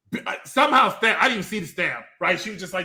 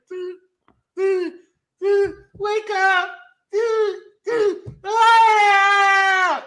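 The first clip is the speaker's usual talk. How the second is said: much higher, louder frantic cry; mock frantic calling; 12 semitones higher, 6 dB louder, much slower than usual. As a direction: call out desperately to wake him. Woman pounding glass, calling.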